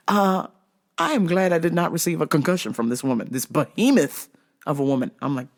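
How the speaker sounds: ordinary delivery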